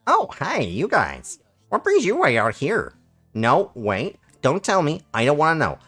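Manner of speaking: high, swooping Canadian shopkeeper patter; Canadian slug merchant. Acted. Slug merchant greeting customers at the market, high Canadian lilt. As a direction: lilt up and down like a chummy Canadian salesman. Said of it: "Why is it becoming gradually more Canadian?".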